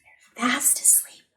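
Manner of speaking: soft unvoiced whisper; whisper, don't wake them. Trucks asleep, a hushed whisper.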